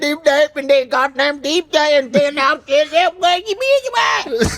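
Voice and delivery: very high, gibberish-filled mock rant. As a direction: spiral up into garbled Jerry Springer ranting.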